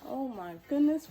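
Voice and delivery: usual speaking voice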